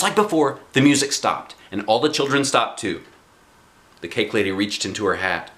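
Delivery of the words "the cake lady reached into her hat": ordinary delivery, nothing marked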